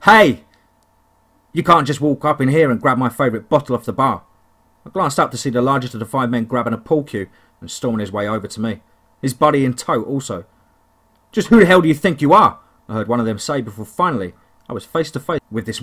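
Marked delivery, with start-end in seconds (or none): 0.0-4.2 angry. Acted. Angry trucker confronts narrator. 11.3-12.6 loud threatening yell; angry shout. Acted. Man storming over with pool cue.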